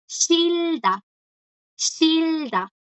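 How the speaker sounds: "shilda" high and drawn out, falling at the end; silent consonant demo. Shown as a demonstration. Teacher shows the Korean verb odiar, slow high pronunciation demo. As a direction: model "shilda" slowly, twice, with the batchim silent. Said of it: "La J aquí no suena".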